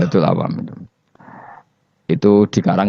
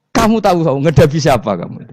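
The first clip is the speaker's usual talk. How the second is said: raised, pushy voice lording it over the grave angels; boastful Umar; 5 semitones higher than usual. Pretend. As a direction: puff up and brag about who you know. Umar boasts to Munkar Nakir.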